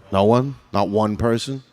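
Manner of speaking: ordinary talk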